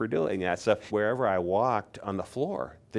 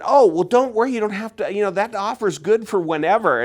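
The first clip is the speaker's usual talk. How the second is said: much higher and louder, eager reassurance; reassuring buyer; 13 semitones higher, 10 dB louder than usual. Retold or imitated. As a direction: wave off his hesitation, excitedly. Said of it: "he said".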